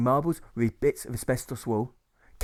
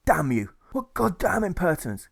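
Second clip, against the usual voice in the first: higher, indignant squawk; squawking outrage; 6 semitones higher, 3 dB louder than usual. A narrator's reading. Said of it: "he says with a squawk".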